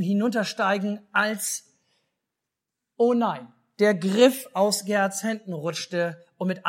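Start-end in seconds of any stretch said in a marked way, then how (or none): 3.0-3.5 sudden alarmed cry, louder; startled oh no, a bit louder. Acted. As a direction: gasp it out as the box slips. Box slips from his hands.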